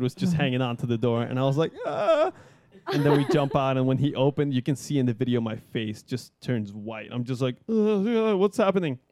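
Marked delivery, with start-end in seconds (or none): 1.9-2.3 high-pitched drawn-out wail; mock terrified cry. First-timer at the open door, high mock wail. 7.7-9.0 high stammering groan into a question; mock bewildered panic. First-timer in freefall, high dazed panic.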